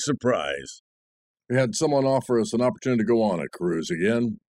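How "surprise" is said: surprise said much lower and softer, falling; dry, deadpan surprise; wry surprise. Acted. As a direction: sardonic low echo of surprise.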